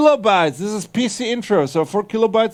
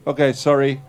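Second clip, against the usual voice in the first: ordinary delivery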